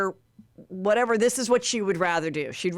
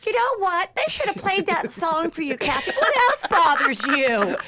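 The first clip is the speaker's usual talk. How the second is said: mocking impression; 10 semitones higher, 3 dB louder than usual. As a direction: coo it with mock sympathy. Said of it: "Lisa Rinna's like".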